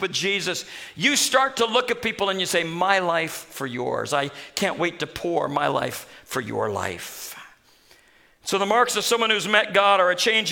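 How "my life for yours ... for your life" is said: slow, soft and low, giving himself away; pledging his life to a neighbour, gently; tender vow. Acted. Preacher voicing a believer's vow to others, slow and soft.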